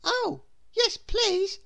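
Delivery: put-on high, eager child's reply; excited small boy says yes; little boy voice. A narrator's reading. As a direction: answer with a boy's delight. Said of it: "replied Jack".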